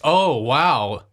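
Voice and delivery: ordinary delivery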